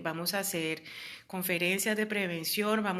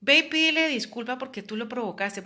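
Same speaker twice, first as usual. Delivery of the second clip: higher, louder mock scolding; bossy advice giver; 5 semitones higher, 5 dB louder than usual. People telling a victim to apologize, mock scolding. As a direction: lightly play someone pushing you to apologize. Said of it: "inclusive hay personas que te dicen".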